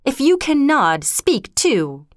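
mild roar, starting high then falling. A narrator's reading. King confronts the ghost, a controlled roar. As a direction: challenge it with a restrained, high-pitched roar. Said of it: "Then to the ghost he roared".

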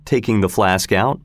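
ordinary delivery

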